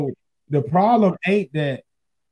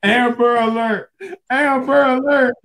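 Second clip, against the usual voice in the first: mock alarm call; 8 semitones higher, 4 dB louder than usual. Jokingly sounding an alarm.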